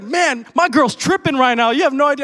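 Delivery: loud, high imitation of a blown-away man; freaking out. Retelling a guy telling the preacher about his girl, freaking out. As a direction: exclaim it high and loud, as the amazed listener. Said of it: "freaking out".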